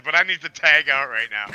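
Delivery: normal talking voice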